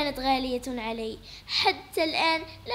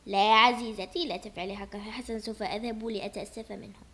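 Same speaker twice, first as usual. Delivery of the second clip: soothing husband; 6 semitones lower than usual. Acted. Father soothing wife.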